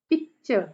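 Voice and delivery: Picture with strong पिक, dropping to light चर; trochaic stress. Shown as a demonstration. Teacher gives trochee example, first syllable of picture stressed. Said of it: "पहला stress है".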